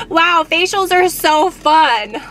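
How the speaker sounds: high, loud, drawn-out sarcastic gushing; mock enthusiasm. Customer reacting to facialist's criticism of her skin, mock enthusiasm. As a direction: stretch it out slowly with fake delight.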